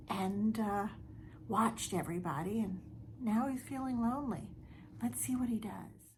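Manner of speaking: soft, fading to a whisper; hushed storytelling. A narrator's reading. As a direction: lean in and whisper the last part.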